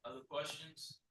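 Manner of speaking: sounding far off in a well; inside a well. Real, not acted. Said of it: "you sound like you're in a well".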